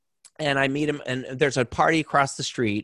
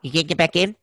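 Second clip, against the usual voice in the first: lightly voiced impersonation, pitched up; a hint of a higher, incredulous questioner; Joe Pesci; 5 semitones higher, faster than usual. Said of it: "and Pesci goes".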